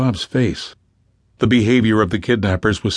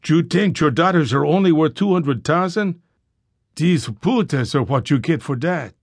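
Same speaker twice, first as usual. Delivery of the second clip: put-on higher voice, thick Spanish accent, sneering; Spanish-accented English; 6 semitones higher than usual. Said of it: "He addressed the fathers in accented English".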